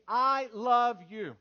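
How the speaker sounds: loud, drawn-out congregational prompt; leading the congregation. Shown as a demonstration. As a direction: say it big and slow for everyone to repeat. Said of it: "Everybody say this with me".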